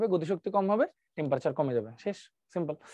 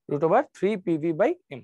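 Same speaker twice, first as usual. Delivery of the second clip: slow dictation; much slower than usual. Shown as a demonstration. Dictating the final answer.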